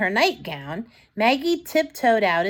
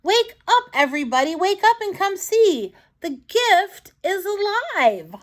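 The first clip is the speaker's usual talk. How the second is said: high, excited calling out to the family; bursting with happiness; joyful shout; 9 semitones higher than usual. Acted. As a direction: wake the whole house with joy. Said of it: "laugh or cry or shout with joy".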